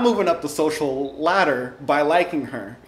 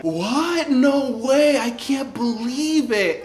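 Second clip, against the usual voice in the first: put-on high, slow, gasping girly disbelief; gossiping girls; 9 semitones higher, much slower than usual. Popular girls reacting to gossip, high gushing disbelief. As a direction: play a gushing middle-school gossip.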